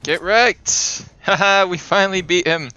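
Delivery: loud, high, gloating victory cheer; triumphant shouting. Real, not acted. Player finally beats the boss, jubilant shouting. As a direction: crow over the win at full volume.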